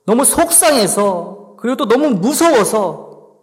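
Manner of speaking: a believer threatening God in despair, pleading; desperate threat. Acted. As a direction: press God hard, at the end of your rope. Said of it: "하나님한테 협박을 하고 있는 거예요".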